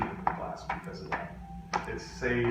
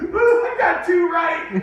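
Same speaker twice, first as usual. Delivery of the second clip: much higher, much louder, slow whooping triumph; gleeful shout; 12 dB louder, slower than usual. Retold or imitated. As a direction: crow about it with laughter. Gloating over his surprise A.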